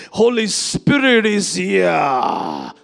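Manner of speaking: loud, drawn-out preacher's proclamation; Pastor Benny. Retold or imitated. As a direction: boom it slowly, stretching the last word. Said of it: "That's how he talks".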